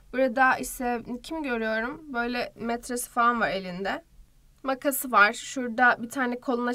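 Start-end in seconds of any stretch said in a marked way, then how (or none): none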